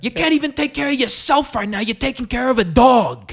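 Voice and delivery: loud, high incredulous bellow; hammed-up father blowing up; outraged dad. Dad hearing about the dog, outraged imitation yelling. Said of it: "You're doing a good impression right now".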